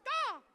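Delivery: cartoon crow call; bird caw. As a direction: squawk once like a bird. Imitates bird between chanted drug words.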